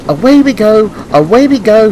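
high, loud, eager cheer; excited puffing engine. A narrator's reading. Thomas sets off past the green signal, excited high puffing. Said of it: "he puffed".

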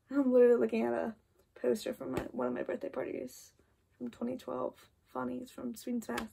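usual speaking voice

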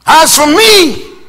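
loud, high, slow declaration; proclaiming shout. Acted. Preacher leading his congregation in a vow, loud. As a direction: lead the crowd in the vow. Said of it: "say it with me".

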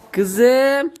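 high, loud call to the calf; calling a pet. Real, not acted. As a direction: call the animal sweetly. Calls the calf. (hayvana tiz sesle seslenme)

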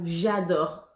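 usual speaking voice

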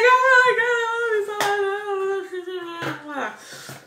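very high, drawn-out gushing squeal; fangirl squeal. Retold or imitated. Starstruck fan gushing to her idol, high squealing. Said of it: "I was like".

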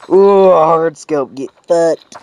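loud, excited cheer. Real, not acted. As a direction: hype up the kill. Excited hard scope kill.

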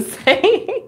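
ordinary delivery

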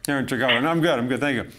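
talking while eating; mouth full. Real, not acted. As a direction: talk through a mouthful. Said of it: "trying to talk and eat".

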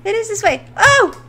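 high, loud frightened cries; panicked yelps. Real, not acted. Gamer fleeing monsters in a dark cave, panicked. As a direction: yelp in panic as you run.

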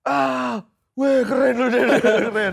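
high, very loud laughing whoop; friends' excited shout, acted out; imitated cheering. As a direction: whoop in mock admiration, laughing. Acts out friends cheering the driver.

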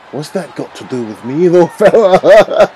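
put-on a Scottish accent with a laugh into "fella". Sheamus brushing off the wrestler, in a Scottish accent. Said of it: "Allow my Scottish accent".

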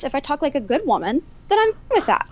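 normal talking voice

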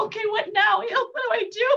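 high-pitched, laughing fluster; giddy mock panic. Woman retelling his unexpected yes, giddy mock panic. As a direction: act out being caught unprepared, laughing.

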